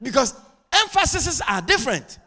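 high, loud, driving sermon voice; impassioned preaching. Real, not acted. Preacher telling church members to adapt, full-force preaching.

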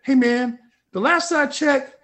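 loud, high, indignant re-enactment; defiant retort. Retold or imitated. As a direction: tell off a rival, building to a swearing blast. Acts out angry reply to Scott.

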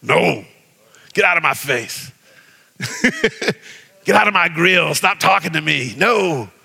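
a touch of defiance telling him off; preacher mildly acting defiant. Shown as a demonstration. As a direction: order the enemy out of your face. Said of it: "You gotta say it with some conviction".

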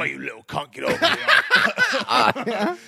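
loud, hammed-up Cockney scolding breaking into laughter; mock Cockney dad. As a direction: yell like a caricature Cockney father. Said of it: "like Cockney as fuck".

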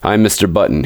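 ordinary delivery

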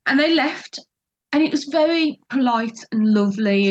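usual speaking voice

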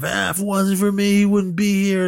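high-pitched retold tirade; mock rant. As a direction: go off like a sore loser. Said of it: "just going off".

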